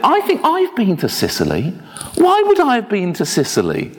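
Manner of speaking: high-pitched, lightly voiced elderly man; quoted old man. Elderly man answers minister's Sicily question, puzzled. Quoting Ted in nursing home.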